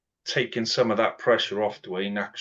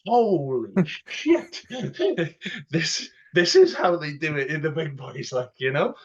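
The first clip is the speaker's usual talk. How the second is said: laughing amazement; 6 semitones higher than usual. Retold or imitated. Young player watching cash fly, laughing in amazement. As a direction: gasp through laughter at the cash. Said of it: "And I go like".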